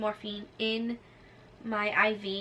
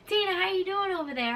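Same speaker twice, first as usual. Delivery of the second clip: high-pitched imitated nurse check-in; calling over; 9 semitones higher than usual. Nurses to patient from the other side, calling over. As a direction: sing it out high to someone across the room.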